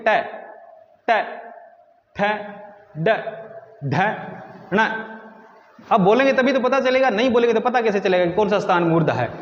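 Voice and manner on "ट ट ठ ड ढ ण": ट, ठ, ड, ढ, ण each said alone with pauses; letter demo. Shown as a demonstration. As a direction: retroflex letters ट, ठ, ड, ढ, ण said apart. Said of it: "बोल के देखिए".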